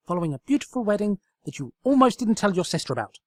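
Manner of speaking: high, loud, eager scheming voice; high-pitched excited pony; excited Twilight. Acted. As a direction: pitch it up like a manic matchmaker.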